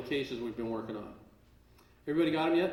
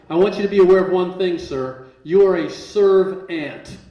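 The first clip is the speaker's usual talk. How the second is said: stern rebuke; 2 semitones higher, 8 dB louder than usual. Retold or imitated. Man telling a marshal his place, stern rebuke. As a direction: put him in his place, drawing out the last words.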